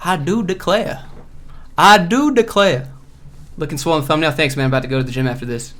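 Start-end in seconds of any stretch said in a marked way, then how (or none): 0.0-1.1 slow mock Southern belle, rising tune; playful Southern imitation; Michael Scott Southern accent. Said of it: "Where Michael Scott's like". 1.8-2.9 slower, higher and louder Southern belle, falling tune; hammed-up Southern belle imitation; Michael Scott Southern accent. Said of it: "Where Michael Scott's like".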